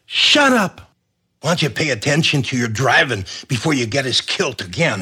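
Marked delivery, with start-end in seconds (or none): 0.1-0.9 a sudden high shout, much louder; sharp shout. Acted. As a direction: bark it out to cut him off. Henry snaps at arguing Donald.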